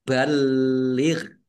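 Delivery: balir, l drawn out so the doubling shows; pressed, held l in balir. Shown as a demonstration. Teacher shows how tasdid is held, l doubled in balir. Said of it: "itu ditekan dan ditahan biar tampak".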